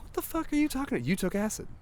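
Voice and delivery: very high, quick mock-wife voice; incredulous wife. Woken wife to tripping husband, high incredulous retort. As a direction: squeak out baffled disbelief at speed.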